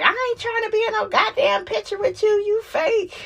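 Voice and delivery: high, fast, dismissive mocking voice; mock Simone brush-off. Mocking Simone refusing a photo with Heavenly, high and fast. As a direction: toss it off high and quick like a snub. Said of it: "Simone is like".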